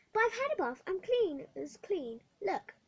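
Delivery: put-on girl arguing with the matron; protesting girl. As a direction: insist you are already clean. Hetty protests she is clean.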